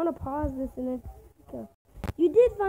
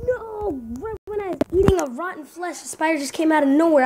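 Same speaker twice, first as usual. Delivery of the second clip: high, indignant protest getting louder; indignant outcry; 4 semitones higher, 4 dB louder than usual. Real, not acted. Player ambushed while eating, indignant. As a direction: cry foul at the top of your range.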